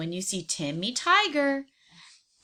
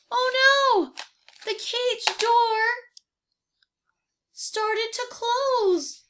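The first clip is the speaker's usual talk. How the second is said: much higher, slow dismayed cry; a worried horse watching the door swing shut; alarmed; 12 semitones higher, 4 dB louder, slower than usual. Acted. Horse sees door closing.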